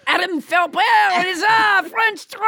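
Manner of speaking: hammed-up puppet announcing himself; French Trump puppet. Puppet Trump introduces himself to the host, mock-grand. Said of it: "it's everybody's least favorite hand puppet, French Trump".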